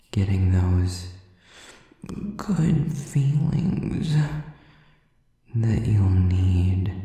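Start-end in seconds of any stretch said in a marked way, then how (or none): 2.4-4.1 high, drawn-out taunt; mock sweetness. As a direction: lift and stretch it mockingly. Villain taunting the listener with a threat.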